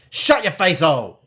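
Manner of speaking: loud fierce snap; a hermit rudely shutting the stranger up; fierce retort. Acted. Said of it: "replies fiercely".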